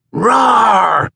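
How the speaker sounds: high, loud pretend-monster roar; playful roar. A narrator's reading. Boy with a lightsaber ambushes Landon, playful loud roar. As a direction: roar like a kid springing an ambush.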